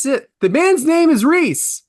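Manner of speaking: high, drawn-out insistence; mock-indignant correction, slow and high; exasperated insistence. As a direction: stretch it slowly and pitch it way up. Imitates podcast partner insisting on the name.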